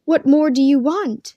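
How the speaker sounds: lightly voiced girl pressing her brother; demanding. A narrator's reading. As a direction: demand it insistently. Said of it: "she demanded".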